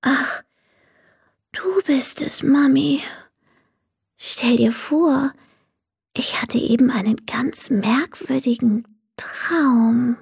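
just woken from a dream, whispering; sleepy whisper. Acted. Max whispers to his mother about his dream. Boy waking, talking to mum.

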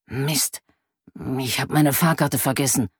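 lightly whispered, as if not to be overheard; whispering. A narrator's reading. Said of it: "flüstert er".

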